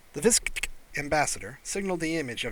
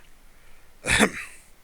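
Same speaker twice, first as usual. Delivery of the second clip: throat clearing; 5 dB louder than usual. A narrator's reading. Doctor clears throat before reading.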